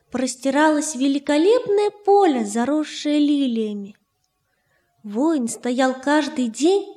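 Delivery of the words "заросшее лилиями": plain reading voice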